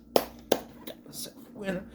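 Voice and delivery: hushed echo of a quote; mock-secretive whisper; whispered catchphrase. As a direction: whisper it to yourself. Echoes the catchphrase, fading to whisper.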